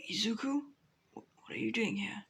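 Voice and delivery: hushed, whispery stammered question; weak disbelief. Acted. Freed Bakugo on his knees seeing Izuku, weakly stunned. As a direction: barely get the words out, stunned.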